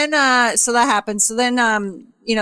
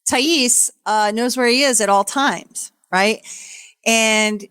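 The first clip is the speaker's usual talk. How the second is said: ordinary talk, nothing marked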